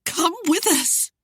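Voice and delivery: high, slow, pleading; frightened woman's high entreaty; fearful cry. A narrator's reading. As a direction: beg him fearfully, drawn out. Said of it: "Ariane cried fearfully".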